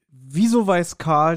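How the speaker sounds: ordinary talk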